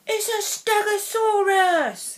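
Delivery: put-on a boy showing off his toy, high and slow; Russell. A narrator's reading. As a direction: boast about the dinosaur, high and drawn out. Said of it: "he said".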